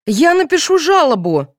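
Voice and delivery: high-pitched indignant threat; flaring up. A narrator's reading. Mother threatening the policeman with complaint, flaring up. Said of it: "взвилась Яковлева".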